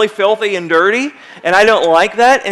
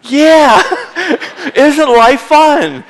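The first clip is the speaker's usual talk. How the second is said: loud laughing yeah, slow ironic question; 6 semitones higher, 5 dB louder, much slower than usual. Real, not acted. Preacher laughing at his own joke to the congregation, louder. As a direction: laugh through the irony, louder.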